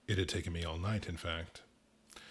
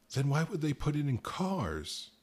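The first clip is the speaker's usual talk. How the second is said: desperate; 9 semitones higher, 4 dB louder than usual. Acted. Narrator desperate question.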